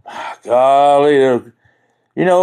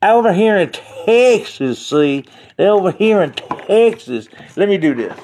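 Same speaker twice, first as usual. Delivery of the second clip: slow, higher mimic of another man saying "Over here in Texas"; imitating that guy; 5 semitones higher, slower than usual. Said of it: "let me do it like that guy said".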